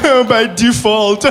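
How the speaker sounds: high, drawn-out crying voice; mock wail. Son reacting to his mom's joke, mock wail. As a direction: wail it slowly through fake sobs. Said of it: "So I'm crying for everything".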